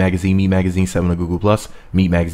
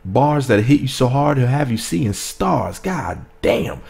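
ordinary talk

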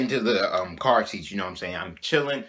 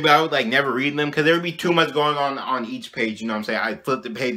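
ordinary talk

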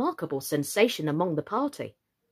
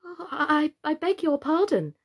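higher, hesitant apology; very humble; 7 semitones higher than usual. A narrator's reading. Alice apologising to the mouse, very humbly. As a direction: apologise meekly in a lightly raised girl's voice. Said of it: "said Alice very humbly".

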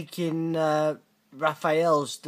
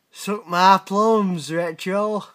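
mock taunt; 4 semitones higher, 8 dB louder, slower than usual. Taunting his friend Retro.

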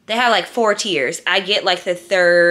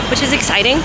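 dry sarcastic tone; 3 semitones higher, 4 dB louder, faster than usual. Real, not acted. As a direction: say it like it's obviously not exciting.

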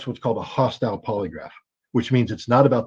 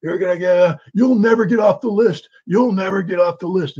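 high, raised threatening yell; retold screaming; 7 semitones higher than usual. Agents screaming threats at Marina as she leaves, raised yell. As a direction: threaten her like a red-faced agent. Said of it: "they're screaming at her".

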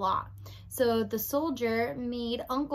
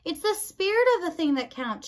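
higher, raised voice for poor ears; mild shout, pitched high; shouted repeat; 6 semitones higher, 4 dB louder than usual. A narrator's reading. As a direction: repeat it loudly so she hears. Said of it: "shouted P".

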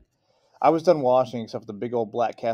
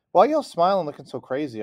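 whined; 6 semitones higher, 6 dB louder than usual. A narrator's reading. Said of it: "I whined".

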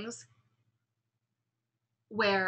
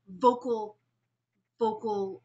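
pushed consonants on "vocal"; vocal emphasis; much slower than usual. Shown as a demonstration. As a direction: punch the V and C in "vocal". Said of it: "I can emphasize something".

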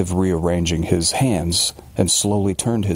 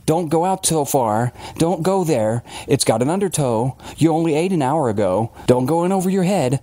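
imitating a nagging mother, higher pitch; mock yell of anxious warnings, pitched up; mock maternal scream; 9 semitones higher than usual. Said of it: "scream herself hoarse".

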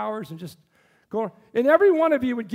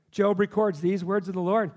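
ordinary talk

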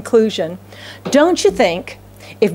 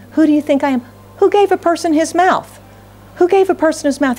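God's challenge; 7 semitones higher, faster than usual. Acted. Preacher voicing God's rebuke.

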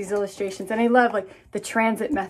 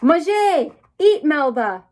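higher calling voice for Maman Lucy; 5 semitones higher, 5 dB louder, slower than usual. Acted. Maman Lucy calling Melba to eat, higher and calling. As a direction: call the child in to eat. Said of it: "calls Maman Lucy".

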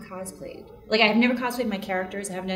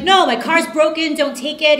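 emphatic refusal; 8 semitones higher, 9 dB louder than usual. Retold or imitated. Refusing brother in retold story.

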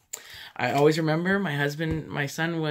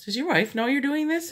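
doubtful hairdresser; 10 semitones higher, 3 dB louder than usual. Retold or imitated. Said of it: "she goes".

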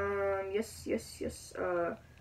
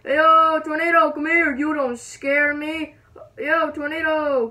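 loud, higher-pitched mock challenge; calling out defiantly; 5 semitones higher, 8 dB louder, slower than usual. Gamer daring the tornado to come, defiant shout. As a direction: taunt it at full voice, words stretched out.

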